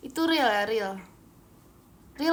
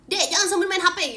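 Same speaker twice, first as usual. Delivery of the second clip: louder, higher mock scolding of a kid; imitating the security guard; 5 semitones higher, 6 dB louder than usual. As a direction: tell the student off like the school guard. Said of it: "diomelin security sekolah aku".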